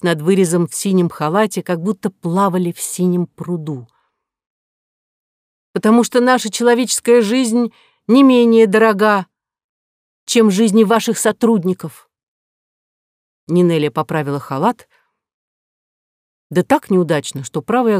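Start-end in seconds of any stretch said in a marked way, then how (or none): 5.7-12.1 higher, louder indignant retort; indignant woman. A narrator's reading. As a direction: scold him firmly. Ninelya's retort.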